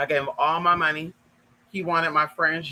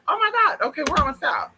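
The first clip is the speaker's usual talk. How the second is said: high, breathless mock relief; sudden high relief at the exit; relieved; 10 semitones higher than usual. Seeing the end of the street, high relief.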